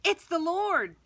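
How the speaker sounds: excited. Acted. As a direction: cry out the recognition. John recognizes Jesus on shore.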